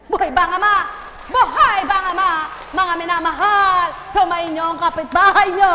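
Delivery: high and loud; loud preaching call. Acted. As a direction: rouse them with a shouted call. Preacher calling to crowd.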